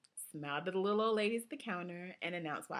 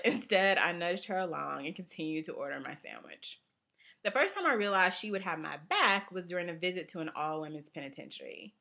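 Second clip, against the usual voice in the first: ordinary delivery, nothing marked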